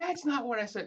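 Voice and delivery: high mock-indignant wife correcting him; imitating the wife. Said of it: "she's gonna be like".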